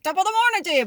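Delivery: playful stock Irish greeting, pitched high; hammed-up Irish brogue; Irish accent. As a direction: toss it off in a cheery mock Irish accent. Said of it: "speaking in accents lately".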